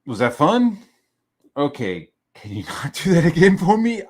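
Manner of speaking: over-bright polite exasperation; strained politeness. Retold or imitated. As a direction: ask with exaggerated parental patience. Retold parent confronting child.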